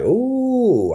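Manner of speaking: light up at the idea; excited ooh. Real, not acted. Streamer gets excited about tasting kangaroo meat, high ooh.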